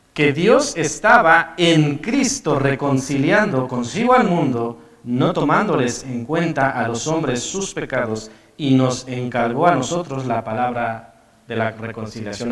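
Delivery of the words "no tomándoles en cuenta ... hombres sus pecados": ordinary talk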